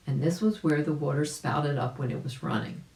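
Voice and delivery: ordinary delivery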